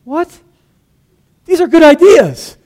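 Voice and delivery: high, loud, slowed protest of disbelief; stunned what, then slow emphatic defence; incredulous. Retold or imitated. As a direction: retell his own baffled outrage. And I'm like.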